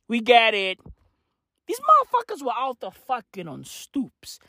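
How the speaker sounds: usual speaking voice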